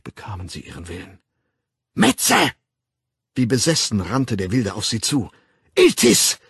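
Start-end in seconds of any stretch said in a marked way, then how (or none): 1.9-2.5 high, loud insult; furious shout. A narrator's reading. The Savage rushing at the young woman, furious shout. 5.7-6.4 high, loud insult; furious shout. A narrator's reading. The Savage rushing at the young woman, furious shout.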